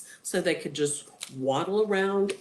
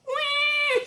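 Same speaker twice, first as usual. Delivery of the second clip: put-on high-pitched baby "wah"; mock baby cry, held high; imitated baby wail; 4 dB louder than usual. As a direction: wail like an infant. Baby cries in story.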